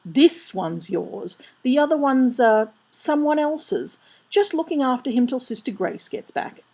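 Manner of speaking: high, flustered nun explaining; hurried reassurance. A narrator's reading. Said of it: "said Sister Mary hurriedly".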